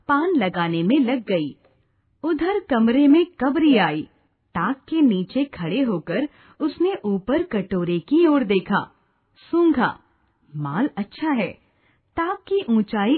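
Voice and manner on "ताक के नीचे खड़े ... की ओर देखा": ordinary delivery, nothing marked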